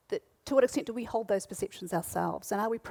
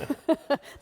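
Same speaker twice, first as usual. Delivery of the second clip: short amused laugh, pitched up; light laugh; 7 semitones higher, 3 dB louder than usual. Real, not acted. Moderator laughing at the red socks story. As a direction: let a quick laugh slip out.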